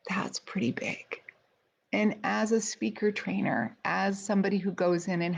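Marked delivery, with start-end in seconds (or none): 0.1-1.2 low, hushed whisper of awe; awed whisper. Retold or imitated. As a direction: breathe it out, stunned by the thought. Awed inner thought.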